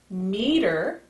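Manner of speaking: ordinary delivery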